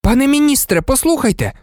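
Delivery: put-on high, urgent boy's plea; urgent exclamation. A narrator's reading. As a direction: call out desperately to be heard. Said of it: "вигукнув Гаррі".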